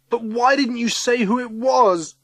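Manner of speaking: high, loud, pained question; upset demand. A narrator's reading. Ryan asking Missus Summers about her silence, upset. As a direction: press her for an answer.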